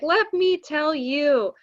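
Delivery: high, loud, slow mom impression; hammed-up motherly emphasis; emphatic mother. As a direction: stretch it out like a mother with a tale to tell. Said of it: "And she's like".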